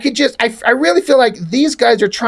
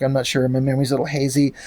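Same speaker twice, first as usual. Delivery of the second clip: hazy murmur; 7 semitones lower, 6 dB quieter, much faster than usual. Acted. Julie's hazy answer acted.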